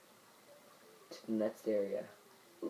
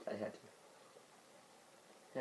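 whispered apology; 16 dB quieter, much slower than usual. Pretend. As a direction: breathe it out, no voice. Apologetic aside after kill.

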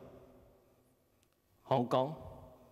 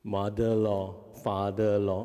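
put-on mumbling teen; 4 semitones lower than usual. Teen's mumbled answer quoted.

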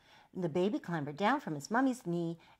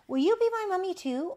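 high, loud teddy voice; pleading teddy; 9 dB louder than usual. A narrator's reading. Teddy pestering the new mummy, high loud plea. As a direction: beg it high and insistent. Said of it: "over and over and over again".

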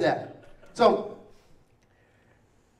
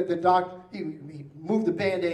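ordinary talk, nothing marked

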